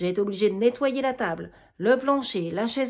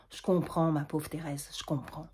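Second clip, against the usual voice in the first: consoling; 6 semitones lower, 8 dB quieter than usual. Acted. Germaine consoling Thérèse.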